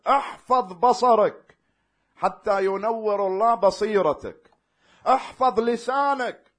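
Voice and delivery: preaching command. Acted. Preacher commanding the congregation, fervent preaching. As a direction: command them to guard their sight. Commands to guard sight, tongue.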